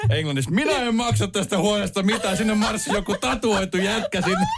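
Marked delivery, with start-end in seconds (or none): none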